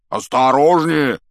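loud, drawn-out warning roar; big giant's shout; roaring growl. A narrator's reading. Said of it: "рыкнул Хагрид".

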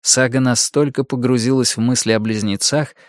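plain reading voice

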